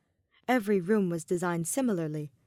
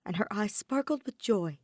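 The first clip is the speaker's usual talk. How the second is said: usual speaking voice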